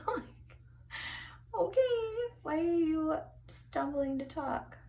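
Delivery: high, squeaky teasing voice; mock self-scolding. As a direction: tease yourself in a silly high voice.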